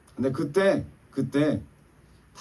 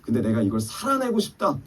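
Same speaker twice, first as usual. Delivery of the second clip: preaching exhortation; 10 semitones higher, 4 dB louder than usual. Acted. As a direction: lift the congregation, press each phrase.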